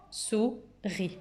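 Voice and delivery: "Souris" softly split into sou and ri; soft "sou", pause, soft "ri"; syllable by syllable. Shown as a demonstration. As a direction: quietly count out "Sou-ris". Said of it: "Deux syllabes".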